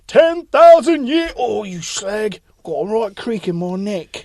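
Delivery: slow, mock Cockney genie groan; Statham as genie. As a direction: stretch it out like a creaky old genie. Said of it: "He'll come out like Robin Williams".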